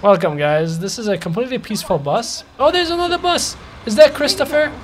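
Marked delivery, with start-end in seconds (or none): 2.6-4.7 high-pitched, delighted surprise; excited spotting. Real, not acted. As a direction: pipe up high at spotting your employee. Spots his employee's bus.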